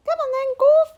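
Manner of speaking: very high, loud chirpy bird. Acted. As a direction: call him on like a chirpy bird. Bird calling Gorf.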